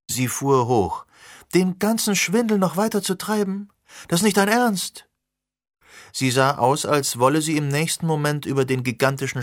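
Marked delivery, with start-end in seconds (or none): 1.5-5.1 high, indignant outburst; outraged. Acted. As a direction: burst out in disbelief. Sirona jumps up outraged.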